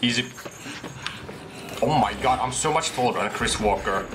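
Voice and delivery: ordinary talk, nothing marked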